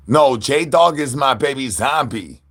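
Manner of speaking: usual speaking voice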